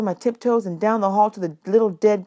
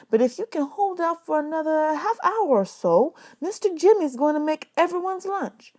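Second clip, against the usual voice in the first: kind woman; 9 semitones higher than usual. A narrator's reading. Miss Thomas voiced high.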